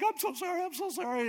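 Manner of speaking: high-pitched pleading apology; hammed-up tearful grovelling; mock weeping. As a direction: beg pitifully in a squeaky voice. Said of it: "he's crying and he's weeping".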